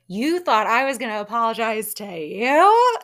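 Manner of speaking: loud mock disbelief, soaring at the end; amused, exaggerated incredulous question. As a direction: imitate an indignant comeback, nearly laughing. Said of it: "how she looked and said when she said it".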